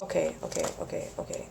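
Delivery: calming herself. Real, not acted. Vlogger overwhelmed by paper, fading whispered calming. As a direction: talk yourself down, dropping to a whisper. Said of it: "I can't go through this entire thing".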